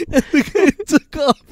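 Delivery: very high, loud, laughing through it; can't stop laughing telling it; real helpless laughter; laughing hard. Real, not acted.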